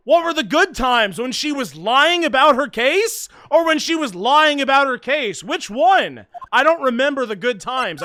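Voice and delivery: loud, high sarcastic jabs; mock outrage at Phoenix; indignant outburst. Mock indignant reply to self.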